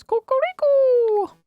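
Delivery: high, drawn-out crowing voice; rooster-like call. Acted. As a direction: crow the name slowly, way up high. Announces with a crowing name.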